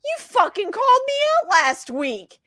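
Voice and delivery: recognizing the actor, thrilled accusation; excited accusation. Retold or imitated. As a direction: catch him out with glee.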